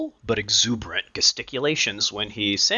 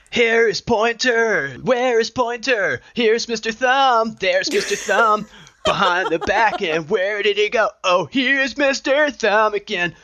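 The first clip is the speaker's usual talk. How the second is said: put-on kids' song teacher; 10 semitones higher, 4 dB louder than usual. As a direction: lead a finger rhyme for preschoolers. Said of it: "Bible school and daycare".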